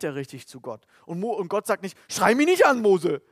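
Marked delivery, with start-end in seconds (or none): 2.1-3.2 high, loud shout of a rebuke; God snapping at Moses to stop shouting, shouted loud; mock shout. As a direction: bark it out as an offended God.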